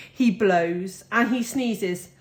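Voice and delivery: ordinary delivery, nothing marked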